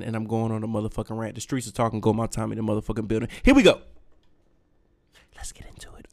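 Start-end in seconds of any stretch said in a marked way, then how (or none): 3.4-3.8 loud high hype call; here we go. Real, not acted. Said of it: "I'm the rant man". 5.4-6.1 hushed whisper. Real, not acted. Hype whisper before rant.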